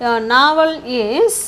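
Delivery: very slow, rising on a long final word; slow dictation. Shown as a demonstration. Teacher adding the helping verb for students, slow dictation. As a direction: hold the last word open for what follows.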